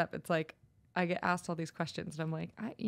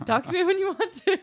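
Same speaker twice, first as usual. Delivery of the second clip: laughing; 11 semitones higher, 9 dB louder, faster than usual. Retold or imitated. Retold dismissive line laughing.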